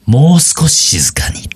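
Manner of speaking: measured, slow mock reprimand; scolding shop lady. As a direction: imitate a shop lady reproving customers, drawn out. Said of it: "中にいたお母さんが".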